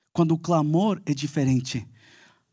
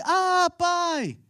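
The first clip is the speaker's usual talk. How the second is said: put-on child's cry; 13 semitones higher than usual. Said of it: "Ele deu um grito que chamou minha atenção".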